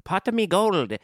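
high-pitched stage-Irish catchphrase; mock Irish accent. Shown as a demonstration. Podcaster seeking a way into Irish, high mock accent. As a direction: try a lilting fake Irish accent. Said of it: "You gotta find the way in".

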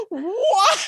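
high, laughing mock gasp of shock; imitating Joey's stunned face, laughing; stunned what. Joey stunned by Pacey, a high laughing what. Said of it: "She's like".

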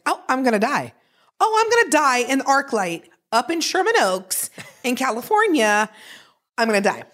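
mock panic. Retold panic attack thoughts.

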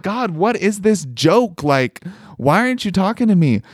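retold frustrated appeal; pleading with God. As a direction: drag it out, baffled and hurt.

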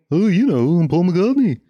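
swooping, slurred celebrity impression; exaggerated singsong mumble, badly done. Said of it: "You've heard my [name]".

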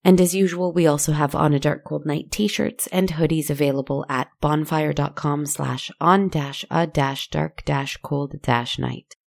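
plain reading voice

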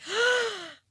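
sharp high mock gasp at a bill; shocked gasp. Shocked at vocal therapy bills.

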